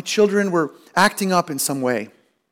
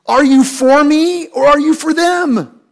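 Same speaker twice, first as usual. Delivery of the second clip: higher, louder and slow, ARE leaned on; indignant accusation; 8 semitones higher, 7 dB louder, slower than usual. Retold or imitated. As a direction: demand loyalty with hammed-up outrage. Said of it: "What I'm thinking is".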